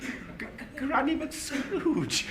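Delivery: trembling as he names her; stammering in fear; frightened stammer. Acted. Scrooge stammers at the ghost.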